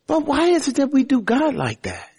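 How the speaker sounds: mock exasperation at the noisy kid; exasperated parent. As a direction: act baffled at a yelling child. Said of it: "You will look and say".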